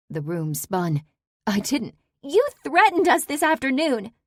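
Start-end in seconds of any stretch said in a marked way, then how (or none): 2.2-4.1 much higher, loud angry charge; furious accusation. Acted. Daughter reminds Saber of her threat, loud and high. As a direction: throw the threat back at her.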